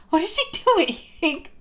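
real laughter through the words, pitch jumping high; laughing. Real, not acted. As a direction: burst out laughing in disbelief.

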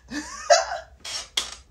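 high squealing laugh; excited laugh. Real, not acted. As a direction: burst into a high delighted laugh. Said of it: "I just got excited".

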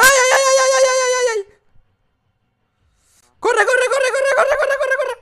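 panicked high screaming; being chased. Real, not acted. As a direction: scream for your life while fleeing. Fleeing monster in horror game.

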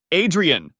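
loud sharp yell of his name. A narrator's reading. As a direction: shout his name across the table. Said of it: "I yell".

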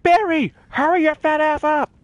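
high, drawn-out imitated yell; mock yelling. Player mimics Nutella yelling at Barry, high mock yell. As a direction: yell it impatiently as an impression of a bossy partner. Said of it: "Nutella just yells".

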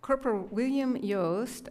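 normal talking voice

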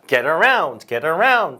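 each "around" punched, "Get" kept light; repeated demo, falling emphasis on "around"; stress on "around". Shown as a demonstration. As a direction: drill it with the weight on "around". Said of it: "뒤에 다시요".

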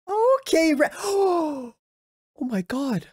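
jolted gasp and yelp at the screen; shocked gasp. Real, not acted. Sudden shock at a scene.

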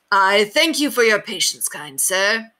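high, formal knightly voice; courteous knight. A narrator's reading. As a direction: thank them with old-world courtesy. Knight thanks William formally.

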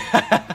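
sudden high burst of laughter. Real, not acted. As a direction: laugh out at the sight. Laughs at friend.

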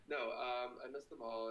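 normal talking voice

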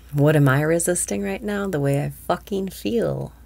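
ordinary delivery

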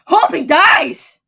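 loud, slow, hammed-up outburst; mock furious wish; spiteful yell. Spiteful reply to boyfriend answer.

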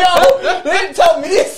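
very loud, high and laughing protest; mock-shocked outburst. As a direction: yell your disbelief through laughter. Retold shock, played for laughs.

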